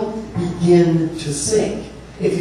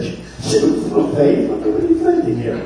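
laughing, jumping way higher on the last words; playful teasing; 12 semitones higher than usual. Acted. Jesus teasing Peter in a headlock, laughing high. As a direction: tease him like a big brother with a laugh.

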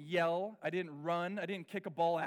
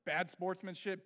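ordinary talk, nothing marked

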